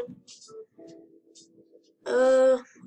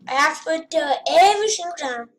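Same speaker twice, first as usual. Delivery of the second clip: ordinary delivery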